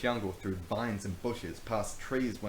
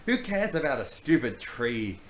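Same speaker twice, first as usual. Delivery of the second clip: loud dismissive scoff; snorting; 4 semitones higher, 7 dB louder than usual. Acted. Brownbeard dismissing Rachel's tree outrage, loud scoff. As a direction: scoff at the fuss. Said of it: "snorted Brownbeard".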